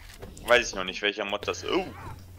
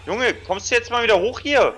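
loud high call; 13 semitones higher, 6 dB louder than usual. Real, not acted. Urging a downed friend.

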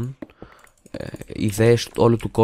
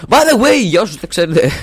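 much higher, much louder sudden shout; 10 semitones higher, 9 dB louder than usual. Real, not acted. Youtuber suddenly shouts an aside to viewers. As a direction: burst out with it.

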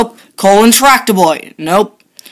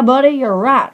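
ordinary talk

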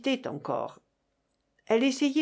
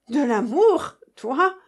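scornful; 3 semitones higher, 6 dB louder, slower than usual. Acted. Mocking retort in quarrel.

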